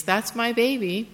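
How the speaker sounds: smiling pride. Retold or imitated. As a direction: say it through a huge smile. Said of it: "A huge smile spread across my face".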